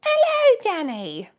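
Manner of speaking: very high, loud hello dropping on the name; put-on sing-out greeting; attempted impression of a friend's greeting. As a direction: squeal the hello in character, then drop. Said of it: "do it the way she does it".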